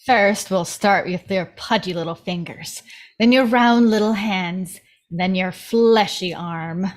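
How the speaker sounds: low, gloating threat; menacing Mama. Acted. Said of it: "We hear Mama's voice over".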